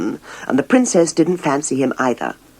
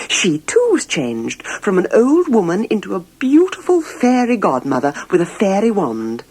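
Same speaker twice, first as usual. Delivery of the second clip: plain reading voice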